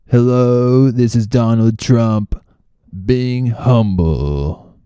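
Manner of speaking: practising a terrible Trump voice; Trump impression. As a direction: drag out the words like a bad Trump. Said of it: "my Trump impression".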